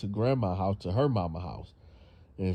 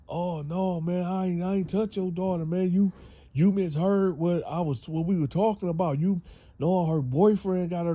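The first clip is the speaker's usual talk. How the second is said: high pleading lie; 8 semitones higher than usual. Retold or imitated. Boyfriend lies his way out.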